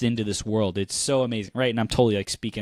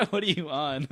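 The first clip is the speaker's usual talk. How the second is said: laughing repeat; 4 semitones higher, 5 dB quieter than usual. Retold or imitated.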